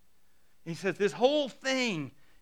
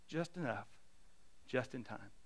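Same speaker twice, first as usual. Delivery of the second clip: soft closing line; 7 semitones lower, 12 dB quieter than usual. Real, not acted. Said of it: "closing line softly".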